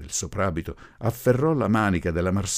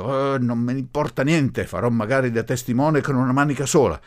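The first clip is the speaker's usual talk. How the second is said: raised, higher, exasperated voice; angry shout; 7 semitones higher, 6 dB louder than usual. A narrator's reading. Professor overruling the grieving bride, angry shout. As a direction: burst out in furious impatience. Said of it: "pestò rabbiosamente un piede, gridando".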